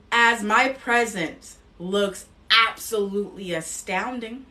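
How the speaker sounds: slow, firm affirmation voice; declaring affirmations. Acted. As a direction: proclaim each line as a truth. Leads spoken affirmations.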